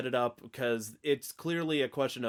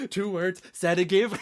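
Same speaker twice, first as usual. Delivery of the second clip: Sedative spoken high and loud through laughter; laughing; 10 semitones higher, 6 dB louder than usual. Real, not acted. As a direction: burst out laughing while saying Sedative. Laughing at the film line.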